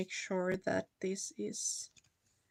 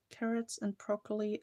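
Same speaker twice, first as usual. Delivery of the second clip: ordinary delivery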